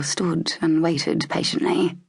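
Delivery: ordinary delivery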